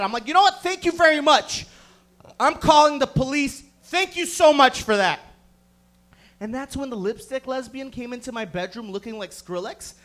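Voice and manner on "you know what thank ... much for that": loud, raised-pitch acted outrage; indignant yelling. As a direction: shout your thanks as a threat. Threatens police in retold fight.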